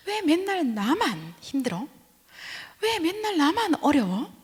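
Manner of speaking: high, slow complaining whine; whiny grumbling. Retold or imitated. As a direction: grumble at God like a sulking child. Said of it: "막 그렇게 투덜거리는 겁니다".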